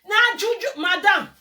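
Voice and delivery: high, loud mocking burst on juju; mocking outburst. Real, not acted. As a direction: throw her words back, loud and high. Mocking the woman's claim.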